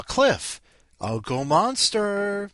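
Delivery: high, loud, drawn-out call; rousing announcement. Pretend. Host introducing Cliff by nickname, calling out high and loud. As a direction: hail the next guest, stretching "Monster".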